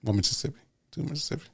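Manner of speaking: hushed mock solemn count; solemn quiet counting. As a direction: count softly like at a memorial. Moment of silence counting.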